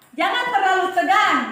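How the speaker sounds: ordinary talk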